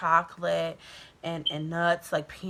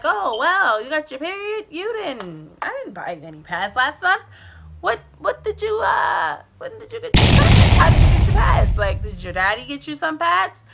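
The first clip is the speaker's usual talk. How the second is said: rising, louder mother's questions; a suspicious mom pressing harder; interrogating mom; 8 semitones higher, 7 dB louder than usual. Retold or imitated.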